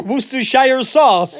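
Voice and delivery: high, loud, slow American Worcestershire; mock American accent. Joining the joke about Americans, slow loud American accent. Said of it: "when the Americans say".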